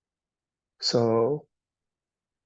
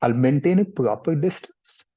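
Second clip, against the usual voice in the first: normal talking voice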